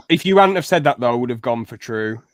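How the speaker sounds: normal talking voice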